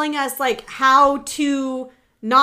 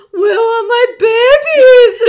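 put-on much higher, louder, slow gushing baby talk to the dogs; 10 semitones higher, 13 dB louder, much slower than usual. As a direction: coo like greeting the puppies. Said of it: "talk to Penny in my Penny voice".

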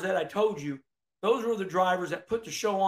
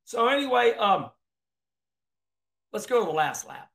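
usual speaking voice

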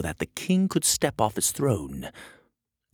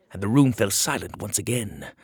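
ordinary delivery, nothing marked

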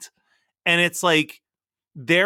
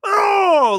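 high, loud, drawn-out "oh" yell; Adam Sandler yell; 10 dB louder than usual. Retold or imitated. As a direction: belt out a mock comedian's holler, high then falling. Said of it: "like, Adam Sandler yell or whatever".

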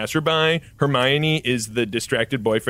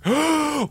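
high breathy mock gasp; awed gasp. Everyone gasps at Mark's legend. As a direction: gasp like a stunned crowd.

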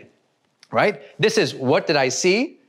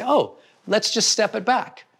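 gentle coaching voice; 6 semitones higher than usual. Retold or imitated. Coach gently slowing down an upset client. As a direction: ease the client into calm. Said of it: "And I say".

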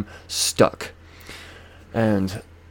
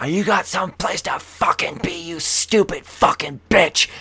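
higher, rasping hushed yell; retold angry snarl; 9 semitones higher than usual. Driver turns on old lady beside him, angry rasp. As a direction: spit it out slowly, holding the volume back.

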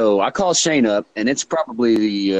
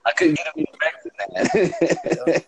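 high, slow words through laughter; real laugh breaking into the words; 12 semitones higher, slower than usual. Real, not acted. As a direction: talk while cracking up. Laughs at own prank story.

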